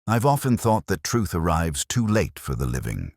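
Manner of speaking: ordinary delivery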